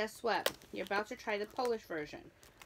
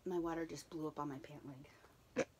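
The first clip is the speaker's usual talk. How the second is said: whispering; 6 semitones lower, 7 dB quieter than usual. Real, not acted. Water spilled on pants.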